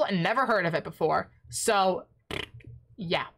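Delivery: ordinary delivery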